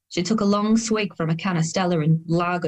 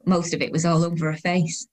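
plain reading voice

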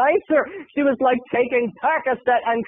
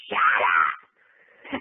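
sudden very low, slow monster growl; deep growled shut up. Retold or imitated. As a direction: drop into a demonic snarl. Diva snaps shut up.

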